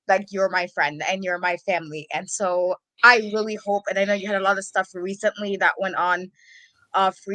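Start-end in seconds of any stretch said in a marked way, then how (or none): none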